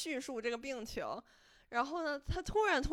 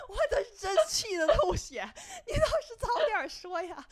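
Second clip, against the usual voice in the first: much higher, louder, laughing mock outrage; laughing exasperation; 11 semitones higher, 7 dB louder than usual. Real, not acted. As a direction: vent the frustration through laughter.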